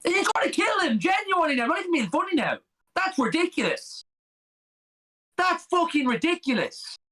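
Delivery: indignant, voice pushed up high; outraged. Real, not acted. Outraged at foul play.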